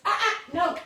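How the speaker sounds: high, sharp warning to dogs; firm no. Real, not acted. Stopping dogs taking pills.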